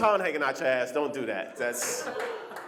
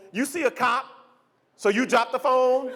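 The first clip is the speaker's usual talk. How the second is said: ordinary talk, nothing marked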